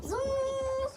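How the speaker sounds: high drawn-out "zoom"; zooming van noise. Retold or imitated. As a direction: imitate a van whizzing by, high and stretched. Imitates van speeding past.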